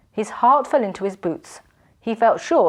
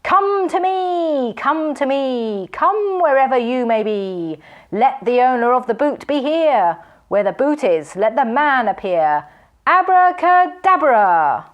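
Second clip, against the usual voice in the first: high, slow booming spell; thunderous incantation; 5 semitones higher, 5 dB louder, slower than usual. A narrator's reading. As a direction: summon him in a voice of thunder. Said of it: "calling in a voice of thunder".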